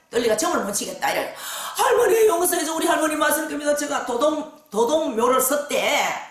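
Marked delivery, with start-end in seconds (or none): 1.8-6.2 pleading cry. Retold or imitated. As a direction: beg grandmother's pardon. Client begging grandmother forgiveness.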